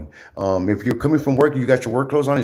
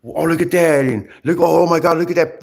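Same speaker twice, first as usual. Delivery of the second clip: mocking gossip; 6 semitones higher, 5 dB louder than usual. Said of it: "Or like".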